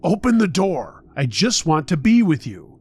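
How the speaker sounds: shouting. A narrator's reading. Intruder demanding to be let into her room, shouting. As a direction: raise your voice, all softness lost. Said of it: "all of a sudden he was shouting".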